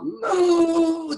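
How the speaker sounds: a long, loud, slow moo; cow mooing. Acted. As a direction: stretch out the cow's moo. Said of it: "the cow".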